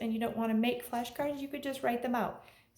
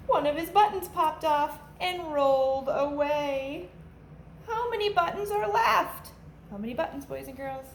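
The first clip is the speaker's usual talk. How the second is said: put-on lively storybook read-aloud for kids; animated picture-book narration for a class; reading to children; 2 semitones higher, slower than usual. A narrator's reading. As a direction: read it like a teacher at story time, bouncy and clear.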